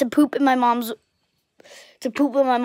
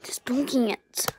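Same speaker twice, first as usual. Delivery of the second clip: whisper; 7 dB quieter, slower than usual. Acted.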